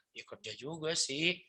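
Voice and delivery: slow, softened grudging reply; mock sheepish admission. Believer conceding to the questioner, acted sheepishness. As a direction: admit it reluctantly, playing it up.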